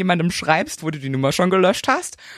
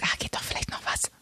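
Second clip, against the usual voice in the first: breathy hushed whisper of hope; 12 dB quieter than usual. Retold or imitated. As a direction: whisper it like a secret wish. Secretly hoping, whispered quote.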